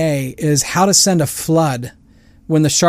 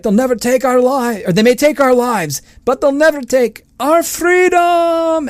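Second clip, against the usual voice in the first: imitating a Scottish accent, pitched higher and climbing to a high peak on freedom; rally the troops in a hammed-up Scottish accent; mock Scottish-accented battle cry to a faltering army; William Wallace Scottish accent; 9 semitones higher than usual. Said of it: "yelling and screaming in this wonderful Scottish accent".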